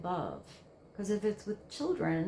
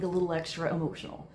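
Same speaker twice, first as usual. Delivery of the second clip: ordinary talk, nothing marked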